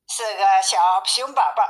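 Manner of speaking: plain reading voice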